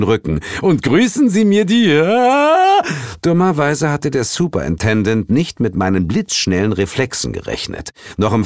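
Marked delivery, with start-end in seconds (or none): 0.6-2.8 loud, high order breaking into a long yell; bellow, then scream as you fall; shouted farewell becoming a falling scream; bluster turning to scream. Acted. Boss pulled in, ends in scream.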